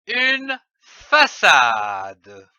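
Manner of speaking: slowly and clearly, each syllable stretched; slow example word. Shown as a demonstration. Teacher slowly giving pupils an example word. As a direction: draw out façade slowly for young readers.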